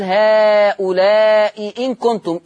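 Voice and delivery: slow chanted "هؤلاء إن كنتم" with the second hamza fully pronounced; Hafs reading. Shown as a demonstration. Teacher showing students the Hafs reading of "هؤلاء إن كنتم". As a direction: recite "هؤلاء إن كنتم" slowly the Hafs way, both hamzas clear. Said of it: "مش زي حفص يقول".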